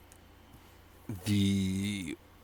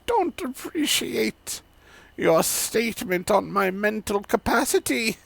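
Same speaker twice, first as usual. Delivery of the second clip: put-on old woman; 11 semitones higher, 4 dB louder than usual.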